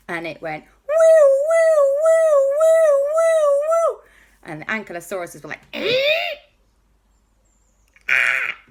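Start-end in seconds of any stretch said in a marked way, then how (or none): 0.6-4.0 very high, loud siren woo; be the fire truck siren; siren wail. Acted. 5.7-8.6 startled dinosaurs. Acted.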